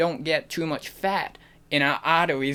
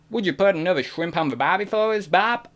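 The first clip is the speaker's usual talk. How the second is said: higher-pitched stock Australian accent; 4 semitones higher than usual. Shown as a demonstration. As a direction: attempt a caricature Aussie accent. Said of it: "I couldn't do Australian".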